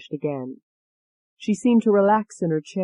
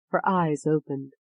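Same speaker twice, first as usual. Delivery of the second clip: ordinary delivery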